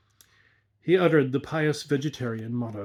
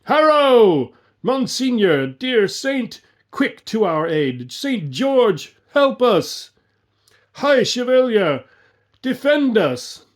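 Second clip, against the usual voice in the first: very high, loud pleading shouts; cry for aid; 8 semitones higher, 6 dB louder, slower than usual. A narrator's reading. Phantom archers crying out in battle, cry for aid. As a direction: call the saint to the rescue. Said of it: "heard the cry".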